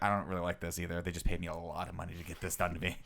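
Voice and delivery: low, soft, unimpressed impression; subdued, deadpan celebrity imitation. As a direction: mumble it quietly, like a reluctant star. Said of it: "That's my [name] impression".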